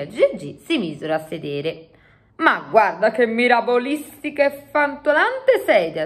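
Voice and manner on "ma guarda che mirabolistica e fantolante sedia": higher-pitched giant voice, marvelling; exclaiming giant. A narrator's reading. Giant admiring the chair made for him, exclaiming. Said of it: "esclamò il gigante".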